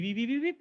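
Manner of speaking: high quick beeps like a power-up; game beeps. Pretend. Presenter voicing Mario game sounds, high playful beeps. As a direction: mimic the coin blips.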